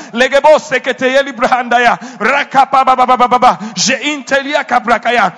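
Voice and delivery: loud, rapid tongues; speaking in tongues. Real, not acted. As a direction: pour out tongues in fervent prayer.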